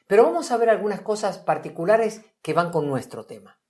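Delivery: ordinary talk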